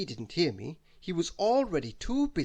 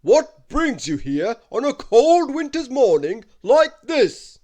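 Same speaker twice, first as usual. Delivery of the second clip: loud, slow booming giant, pitched high; a giant's big, slow welcome; Wizard Winterbottom welcoming; 9 semitones higher, 14 dB louder, slower than usual. Acted. Giant wizard welcoming Christmas into his castle, loud and slow.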